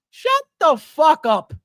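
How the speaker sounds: loud, drawn-out cut-off; forceful, fed-up telling off; exasperated outburst. Real, not acted. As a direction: shut them down hard and slow. Cuts off imagined know-it-all commenter.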